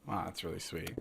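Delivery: whispered. Real, not acted. Host whispers back to Charlie's sincere words, softly. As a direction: hush it like you're touched.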